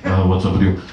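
a hint of a casual dad, low. Dad voiced in a story.

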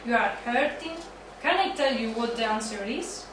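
usual speaking voice

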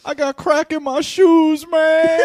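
loud, slow mock wail; crying. Suspect confessing to police, mock crying. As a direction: wail it out like a comic weeping suspect. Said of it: "He just started crying".

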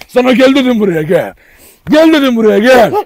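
loud, insistent summons; calling out. Real, not acted. Insisting that the dog comes. (yüksek sesle çağırma)

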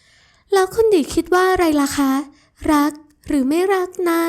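high, slow, playful voice; teasing sweet girl. Acted. Ramon teases Adisa for acting cute, high and playful. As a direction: tease her fondly, voice lifted.

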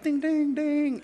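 high sung ding-ding-ding; doorbell chime. Retold or imitated. Ringing Les's back doorbell, high chiming imitation. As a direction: sing the bell up high.

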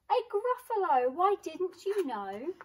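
high, loud scoffing disbelief; mock astonished. Mouse scoffs at the owl.

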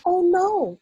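high, loud, distressed cry from a dream; sleep talking. Acted. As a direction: cry out from a nightmare. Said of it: "a slightly blurred sleep talker's voice".

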